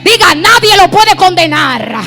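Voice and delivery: loud call-and-repeat pulpit delivery; rousing, shouted sermon climax; full-force preaching. Real, not acted. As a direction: fire out short declarations for the crowd, building to a long final shout.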